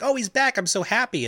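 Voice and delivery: high-pitched mock swooning; gushing delight. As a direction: imitate a lovestruck fan, voice leaping up. Eowyn gushing over Aragorn's return.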